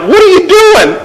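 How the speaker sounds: high, baffled what are you doing; mock exasperated relative; incredulous family. Family member challenges Martha's party plans, high and incredulous. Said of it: "the other people in the house are like".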